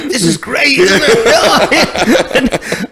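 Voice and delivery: high, loud, laughing self-mockery; mock delight. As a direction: gush with laughing, hammed-up enthusiasm. Mocking young band's delight in songs.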